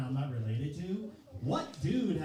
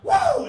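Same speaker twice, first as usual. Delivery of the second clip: very loud, high-pitched woo; crowd whoop; 14 dB louder than usual. Retold or imitated. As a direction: imitate a rowdy fan's whoop. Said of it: "someone goes".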